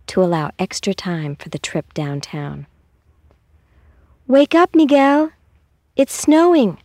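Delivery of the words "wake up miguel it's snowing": higher, louder excited wake-up call; calling out to wake her brother. Acted. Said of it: "Rosa Huerta called to her brother".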